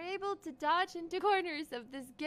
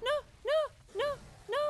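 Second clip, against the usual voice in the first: rapid-fire, raised cries of no; panicked refusal; 7 semitones higher, 5 dB louder, faster than usual. Real, not acted. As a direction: fire off each no in alarm. Panicking at the game threat.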